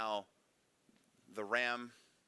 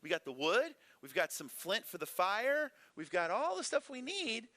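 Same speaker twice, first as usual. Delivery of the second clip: lightly voiced boy, pitched higher and asking; a kid innocently wondering where the lamb is; curious boy Isaac; 9 semitones higher than usual. Son Isaac puzzles to his dad over the missing sheep, higher, curious. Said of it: "He's like".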